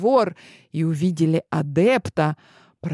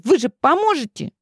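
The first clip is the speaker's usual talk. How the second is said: put-on very high, coaxing girl voice; pleading princess; 11 semitones higher than usual. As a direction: coax them like a spoiled girl. Clementine begs the boys.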